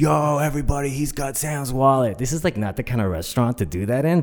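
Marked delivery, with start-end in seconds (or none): 0.0-2.2 high, stretched-out call to the room; excited bartender spreading the news; excited announcement. Retold or imitated. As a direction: announce it to everybody, thrilled. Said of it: "he was so excited".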